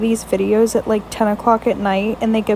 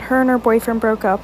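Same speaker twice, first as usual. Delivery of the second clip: ordinary talk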